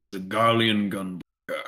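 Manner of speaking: ordinary delivery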